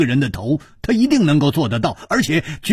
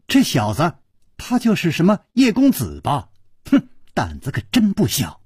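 usual speaking voice

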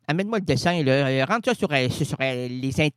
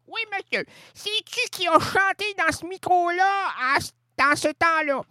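high silly voice; 11 semitones higher than usual. Acted. High mocking voice to the mic.

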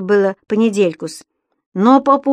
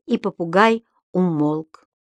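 plain reading voice

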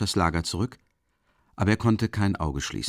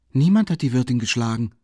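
firm, louder and higher, settling it; stern and decided; 5 semitones higher, 5 dB louder than usual. A narrator's reading. The maid firmly denies the beating to the feverish lodger. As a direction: state it sternly, no room for doubt. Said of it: "in strengem und entschiedenem Ton".

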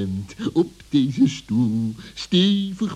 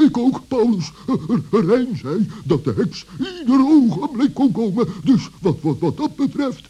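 giggly excitement; 7 semitones higher than usual. Acted.

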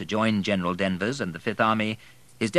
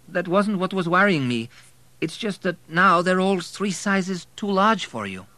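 a higher, playful woman's voice; lightly voiced teasing wife; 11 semitones higher, 5 dB louder than usual. A narrator's reading. Wife teasing Abel about his oversized suits, light higher voice. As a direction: tease him gently.

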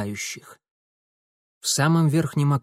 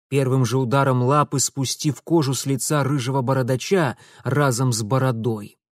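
ordinary delivery, nothing marked

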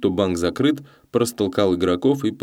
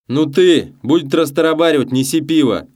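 loud, high, impatient order; shouting at her; 8 semitones higher, 6 dB louder than usual. A narrator's reading. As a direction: yell at her to get moving. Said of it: "крикнул на неё Йоська".

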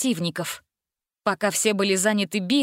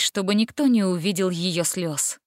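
plain reading voice

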